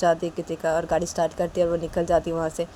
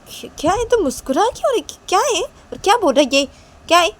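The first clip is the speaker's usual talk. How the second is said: much higher, louder nosy sister voice; teasing big sister; 13 semitones higher, 9 dB louder than usual. Acted. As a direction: pitch way up and needle him.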